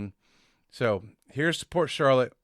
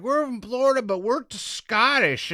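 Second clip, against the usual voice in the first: higher, mock tourist voice; mocking American tourists; 10 semitones higher, 4 dB louder than usual. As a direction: mimic the eager American tourists. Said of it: "they're like".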